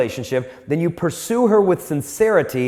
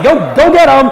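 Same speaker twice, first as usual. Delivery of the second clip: higher, loud cheer; cheering him on; 9 semitones higher, 11 dB louder than usual. Retold or imitated. Speaker cheering the guy who runs off to ask a girl out, loud. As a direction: shout encouragement after him. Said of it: "I'm like".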